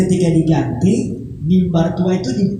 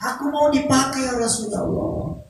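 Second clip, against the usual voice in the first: higher, tender, sorrowful voice of the pulpit; weeping old pulpit; 6 semitones higher, 3 dB quieter than usual. Acted. Old pulpit confessing its sadness to the Prophet, higher and tearful. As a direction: plead like a sad little thing wanting to be used. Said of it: "mungkin kayu".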